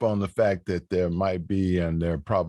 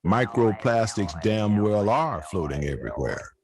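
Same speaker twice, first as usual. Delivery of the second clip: poetry recital. Acted. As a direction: recite it like a stage poet, swelling at line openings. Recites own poem on stage.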